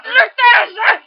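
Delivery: high, loud cheer to kick; urging shout. Acted. Cheering a friend on in the fight, loud high shout.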